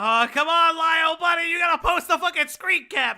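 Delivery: yelling at Lio for the screen cap; calling out. Real, not acted. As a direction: shout over to Lio demanding the screenshot.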